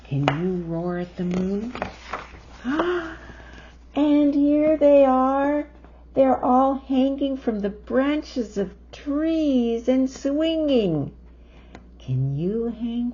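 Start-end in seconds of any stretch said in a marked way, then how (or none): 1.8-3.6 big delighted gasp; excited gasp. Acted. Excited reading to child. 4.0-11.1 higher, louder thrilled telling; excited showing. Real, not acted. Excited pointing out to child.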